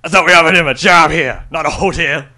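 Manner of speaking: Keith impression. Shown as a demonstration. [name] doing Keith for the podcast, loud and gruff. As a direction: bark it out like an annoyed boss. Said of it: "I'll do my best Keith impression".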